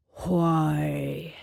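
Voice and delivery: low rumbling monster voice; deep rumbling Minotaur. A narrator's reading. Minotaur questioning the girl, deep and rumbling. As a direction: rumble it like a huge beast. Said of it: "he rumbled in a bass so deep".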